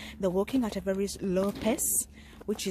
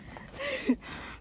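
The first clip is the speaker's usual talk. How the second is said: short high laugh; 4 dB quieter than usual. Real, not acted. Laughs at herself mid-sentence.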